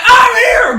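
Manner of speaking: high loud triumphant shout. Retold or imitated. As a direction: shout it out arriving heroically. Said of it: "And I was like".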